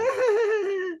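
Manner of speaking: very high mimicked giggle; imitated background laughing. Lady laughs behind the man being asked to do the commandment, imitated high laugh. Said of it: "In, in the background".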